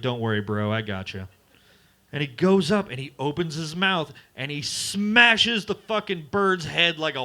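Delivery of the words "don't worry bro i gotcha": low, laid-back dude; low bro voice. Retold or imitated. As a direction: voice the turtle as a chill bro.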